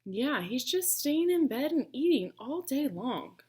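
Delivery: usual speaking voice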